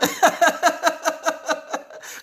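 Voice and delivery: high real laugh; laughter. Real, not acted. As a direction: burst out laughing. Laughing at Curtis' comment.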